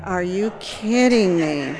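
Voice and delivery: slow, drawn-out disbelief; incredulous. Retold or imitated. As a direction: stretch it out, appalled, for the laugh. Retold disbelief after a laugh.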